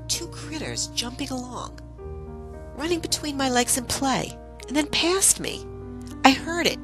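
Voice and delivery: high-pitched, wondering narration; excited storytelling. A narrator's reading. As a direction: build the thrill of the encounter, voice lifted. Excited telling of a fairy encounter.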